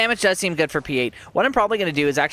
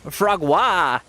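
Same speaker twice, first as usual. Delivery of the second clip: "Frogwa" hammed-up French, louder, pitch up then falling; exaggerated French accent; 5 semitones higher, 3 dB louder than usual. Shown as a demonstration. Streamer says the word again in a hammed-up French accent. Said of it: "than the exaggerated one".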